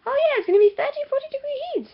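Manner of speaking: loud, very high excited squeal; hammed-up excitement. Holidaymaker bragging about coming heat, hammed-up excitement. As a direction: gush in a silly high voice.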